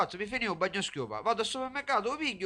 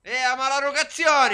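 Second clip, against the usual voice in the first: loud, high outraged shouting; 5 semitones higher, 9 dB louder than usual. Acted. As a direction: yell over the broken laptop. Laptop broken, shouting.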